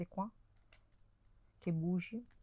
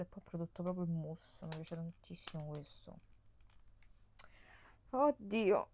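normal talking voice

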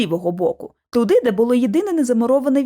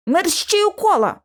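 higher, drawn-out command; urgent order; 7 semitones higher than usual. A narrator's reading. George orders the others back into the circles, urgent command. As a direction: order them to move at once. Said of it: "наказав Джордж".

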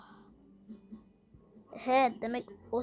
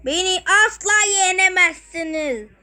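villain gloat; 6 dB louder than usual. Acted. Robot boss taunting the heroes with an evil laugh.

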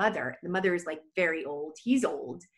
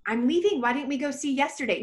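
mock protest; 6 semitones higher, much faster than usual. Protesting to friend, retold.

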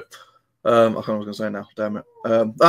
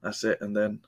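ordinary talk, nothing marked

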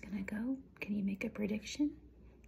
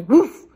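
sudden very loud woof; explosive storybook bark; fierce dog bark; 3 semitones higher, 23 dB louder than usual. A narrator's reading. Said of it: "barked a fierce, angry voice".

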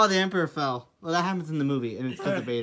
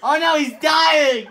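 alarmed cry; 8 semitones higher, 5 dB louder, slower than usual. Acted. Acted alarm.

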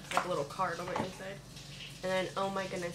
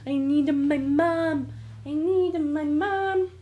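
slow, louder, higher whine for mom; mock whiny baby voice; 5 semitones higher, 6 dB louder, slower than usual. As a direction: play the helpless kid, stretching it out. Mock whine about needing mom.

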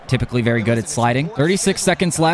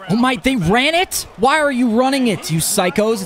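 incredulous outburst; 9 semitones higher than usual. Real, not acted. Opponent runs on fourth down.

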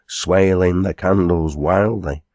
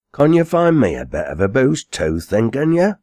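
higher, louder, rising dialect question; quarryman, wheedling question; 7 semitones higher, 4 dB louder than usual. Acted. Quarryman asking the landlady for a bed, higher and hopeful. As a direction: swing the pitch up into a hopeful request.

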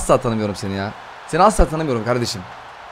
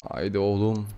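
low, soft coaxing before the reveal; hushed urging; 8 semitones lower, 11 dB quieter, slower than usual. Real, not acted. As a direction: urge the pack under your breath. Low start of a building chant. (alçak sesle gaza getirme)

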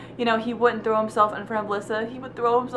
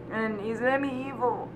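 emotional, quiet; 8 dB quieter than usual. Real, not acted. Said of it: "I'm a little bit emotional".

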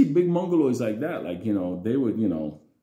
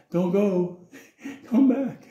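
pleading call; 5 semitones higher, slower than usual. Retold or imitated. Calling after departing father.

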